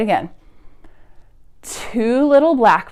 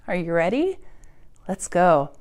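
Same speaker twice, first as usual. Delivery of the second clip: ordinary talk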